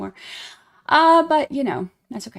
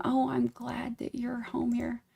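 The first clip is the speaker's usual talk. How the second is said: whisper; 3 semitones higher, 8 dB quieter, slower than usual. Acted. Whispered welcome.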